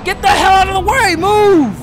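high, urgent yell; angry shout. Real, not acted. Yelling at civilians in the way of his car, angry shout. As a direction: bark them out of the road.

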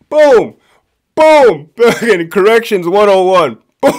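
loud, high, punchy booms; triumphant exclamation. Real, not acted. Storyteller cheers his boy's comeback, loud and triumphant. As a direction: punch each one out like a knockout blow.

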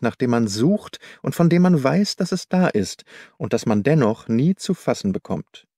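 plain reading voice

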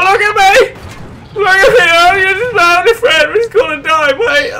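very high, loud wailing shouts; desperate, tearful mock screaming to a co-pilot; hammed-up panic. Acted panic as the plane goes down.

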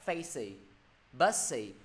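"facey", "bussy" with open "ee" endings; missing final z. Shown as a demonstration. Teacher predicts students' mistake, "facey" and "bussy" cut short of z. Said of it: "Rồi âm giờ của thầy đâu?".

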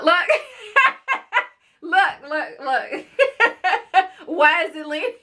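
high giggling look look; laughing. Real, not acted. Mom laughing at the leaning soda. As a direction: laugh through pointing it out.

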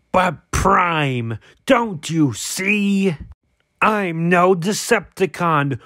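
slow, sinister robot villain taunt. Pretend. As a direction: gloat like an evil warlord. Toy villain taunts Prime.

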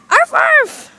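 loud, high yappy barks; dog barks. Pretend. Pet dog barking on the raft, yappy barks. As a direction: bark like an excited little dog.

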